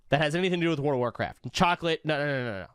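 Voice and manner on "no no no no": usual speaking voice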